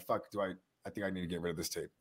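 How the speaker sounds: a nervous mumble to himself, rushed; muttered worry. Retold or imitated. Alone with the tape, muttering fast and low. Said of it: "Just like".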